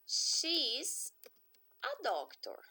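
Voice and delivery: she is, a doctor, said in two chunks with a pause; split demo. Shown as a demonstration. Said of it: "Então, frase afirmativa".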